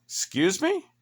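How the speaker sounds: high, incredulous "Excuse me?"; shocked that he'd threaten the tow; indignant excuse me. Retold or imitated. To the condo president, a high indignant "Excuse me?".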